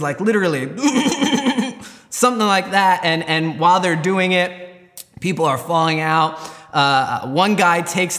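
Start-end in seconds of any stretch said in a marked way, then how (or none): 0.7-1.9 high-pitched rapid-fire stutter; rattling mouth-made gunfire; machine gun noise. Retold or imitated. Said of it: "doing machine gun noises".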